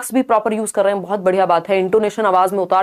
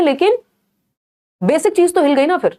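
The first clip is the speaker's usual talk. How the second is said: emphatic; 7 semitones higher than usual. Real, not acted. Emphatic point to students.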